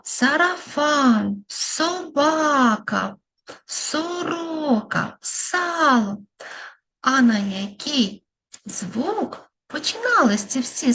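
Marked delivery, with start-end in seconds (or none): 0.0-6.2 very slow, stretched words, a bit higher; drawn-out words. Shown as a demonstration. Teacher to children, slowly repeating the words. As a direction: stretch each word for little listeners. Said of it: "ми з вами вимовляли такі слова".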